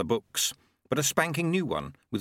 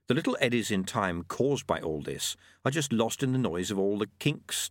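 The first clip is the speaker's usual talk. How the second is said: ordinary delivery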